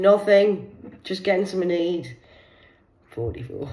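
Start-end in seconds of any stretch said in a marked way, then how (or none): 0.0-2.2 defensive. Retold or imitated. Mum answering defensively.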